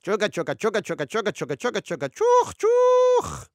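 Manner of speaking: high chugging chant into loud whistle hoots; playful choo-choo chant; sung train noises. A narrator's reading. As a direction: chug along, then hoot high and loud. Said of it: "Oleg sang".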